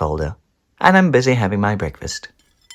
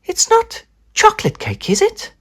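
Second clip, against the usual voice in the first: much higher, knowing; accusing mum. Acted. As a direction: catch him out. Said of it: "mum accusing".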